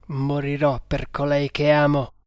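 ordinary delivery

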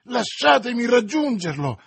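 loud, high, agitated outburst; angry exclamation. A narrator's reading. Young man held back by his friends, furious shouting. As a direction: demand to be let go, furious. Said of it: "pallido di collera e sudato in fronte".

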